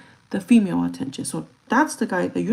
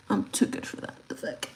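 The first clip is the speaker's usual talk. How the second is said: whisper; 6 dB quieter than usual. Pretend. Mock haughty aside.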